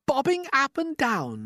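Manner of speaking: very high, slow, shocked cry; cried out. A narrator's reading. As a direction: echo it back in alarm. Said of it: "they cried".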